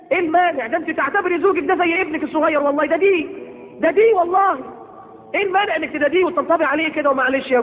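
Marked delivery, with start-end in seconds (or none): none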